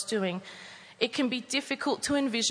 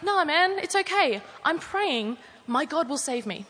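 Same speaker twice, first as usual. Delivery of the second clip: breezy refusal; 6 semitones higher than usual. Acted. Man on roof brushing off the rowboat, carefree and higher. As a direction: wave the rescuer away, unbothered.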